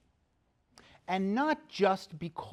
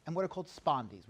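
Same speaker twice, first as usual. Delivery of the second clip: ordinary talk, nothing marked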